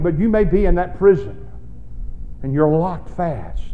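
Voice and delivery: ordinary delivery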